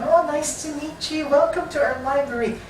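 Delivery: high, bright-toned librarian greeting; friendly librarian. Pretend. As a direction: greet the new member like a cheerful librarian.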